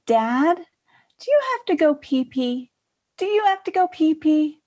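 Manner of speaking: put-on high sing-song voice, as if to a small child; imitating a mother's sugary toddler talk; baby talk to a toddler. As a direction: coax him like a little kid. Sister talking to dad like toddler.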